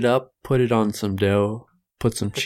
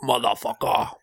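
higher mock British accent; 5 semitones higher than usual. British accent: co-host answering in a mock British cop voice. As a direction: tough British cop threatening.

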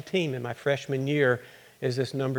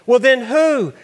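much higher and louder, accusing; angry demand; 9 semitones higher, 8 dB louder, slower than usual. Acted. Mother confronting her pregnant daughter, angry and loud. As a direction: demand the name outright.

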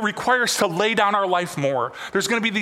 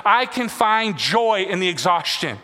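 usual speaking voice

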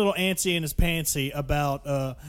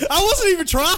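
laughing; 12 semitones higher, 5 dB louder than usual. Real, not acted. Laughing, repeats line.

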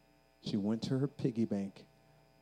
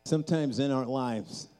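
building, louder fervent altar call; preaching; 3 semitones higher, 6 dB louder than usual. Acted. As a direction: drive it up toward the climax.

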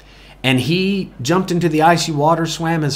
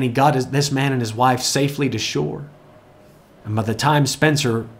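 ordinary delivery

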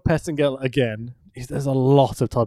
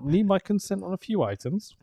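robotic phone voice; 5 semitones higher, 5 dB quieter than usual. Retold or imitated. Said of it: "What the hell was that?".

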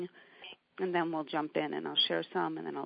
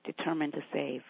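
louder, intense poetry recital; poem reading; 4 dB louder than usual. Acted. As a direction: read the poem with building conviction. Reads Mary Oliver poem.

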